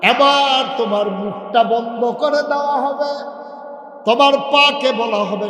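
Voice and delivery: loud, high preaching shout; fiery preaching. Acted. Preacher warning the congregation with a loud shout. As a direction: hammer the warning home.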